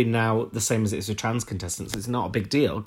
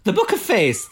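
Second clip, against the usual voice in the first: laughing, higher put-on Irish lilt; mock Irish accent; 11 semitones higher, 5 dB louder than usual. Joining the old-person joke, laughing mock Irish accent. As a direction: toss it off in a silly Irish accent. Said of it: "I don't know why I'm Irish".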